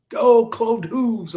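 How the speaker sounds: high, slow mock cheer for Satan. A Yankees hater cheering for Satan's team, high and slow. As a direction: cheer the devil on in a hammed-up voice. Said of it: "I'll root for Satan".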